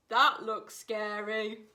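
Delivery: a wary drawn-out call; Hare's voice. Acted. Hare calling from the muddy bank, slow wary voice. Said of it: "called Hare".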